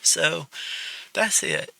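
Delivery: fighting back tears while talking; real tearful, trembling plea; choked up. Real, not acted. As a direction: voice pitched high and breaking with tears. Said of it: "I got a little emotional".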